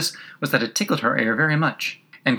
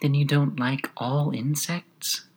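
small, slow, higher creature voice; quiet gnat voice; 4 semitones higher, 4 dB quieter, slower than usual. A narrator's reading. Gnat resuming its question to Alice, quiet voice. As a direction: ask it quietly and unhurriedly, in a small voice. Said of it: "went on as quietly as if nothing had happened".

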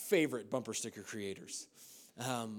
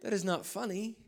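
pitched way up, mock disbelief at dad; incredulous son; 10 semitones higher than usual. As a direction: squeal it like you can't believe it. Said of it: "I was like".

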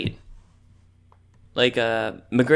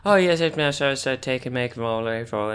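put-on Irish accent. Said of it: "they all talk like".

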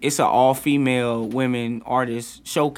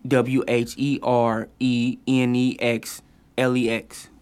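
spelled out; slower than usual. Shown as a demonstration. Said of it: "shout out to Where's Next Lex".

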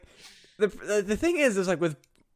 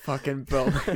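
normal talking voice

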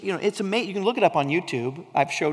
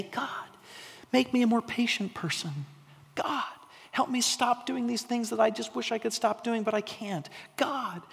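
higher, softer, imploring prayer voice; pleading prayer; 5 semitones higher, 6 dB quieter than usual. Retold or imitated. Believers begging God for easy fixes, higher and softer. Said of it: "We say".

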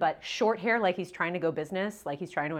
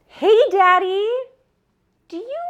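high, sugary asking voice; butter up dad in a high voice; sweet high daddy voice; 14 semitones higher, 12 dB louder than usual. Retold or imitated. Calling dad with awkward request.

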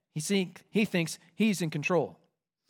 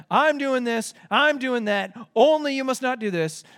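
higher and louder, stressing each "I'm"; bossy mock Pharaoh; 6 semitones higher, 3 dB louder than usual. Preacher mocking Pharaoh playing the one in charge. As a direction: puff up like a king giving orders. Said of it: "tried to sound like he was the one in charge".

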